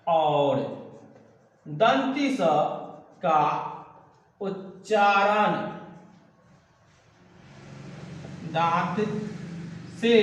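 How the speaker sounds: very slow, long pauses between words; word-by-word dictation. Real, not acted. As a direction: dictate it slowly for note-takers. Teacher dictates while writing, word by word. (शब्द-दर-शब्द धीमा श्रुतलेख)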